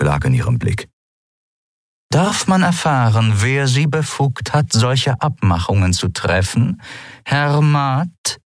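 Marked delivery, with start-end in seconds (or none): 2.1-8.4 a higher, menacing pirate captain, drawn out on the last question; lurking captain's question. Acted. Captain interrogating her disloyal first mate, with lurking menace.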